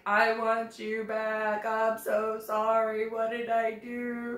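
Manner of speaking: slow, tearful begging; imitating an ugly-crying apology; sobbing plea. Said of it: "ugly crying, sobbing".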